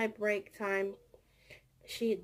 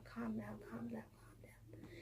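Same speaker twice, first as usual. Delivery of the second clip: soothing whisper; 18 dB quieter than usual. Retold or imitated. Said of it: "for him to calm down".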